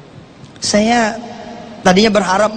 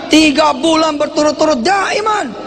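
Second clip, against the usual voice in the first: raised, much higher preaching cry; preaching shout; 8 semitones higher than usual. Real, not acted. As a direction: call it out to the congregation. Preacher stresses three months.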